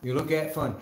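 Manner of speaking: usual speaking voice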